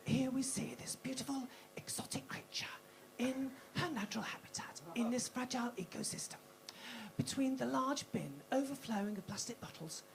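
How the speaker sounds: hushed, breathy wildlife narrator; nature documentary narration. Acted. Comedian setting the scene as a wildlife film, hushed documentary voice. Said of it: "I'll set the scene".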